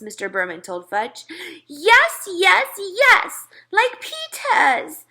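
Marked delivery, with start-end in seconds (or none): 1.7-5.0 put-on high loud toddler holler. A narrator's reading. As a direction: shout it like an excited little kid. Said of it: "Fudge hollered".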